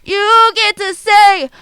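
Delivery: slow, loud, high proclaiming; grand announcer. Acted. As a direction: boom it out like a showman.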